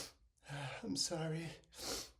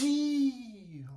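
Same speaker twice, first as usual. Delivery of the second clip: loud sneeze; 3 semitones higher, 11 dB louder than usual. Real, not acted. Sneezing on camera.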